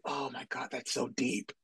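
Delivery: put-on soft, breathy-free hush of a starstruck teen; mock awed teen. Imitating young viewers quietly swooning over the scene. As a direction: gush quietly like a young fan. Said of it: "imagine younger people watching".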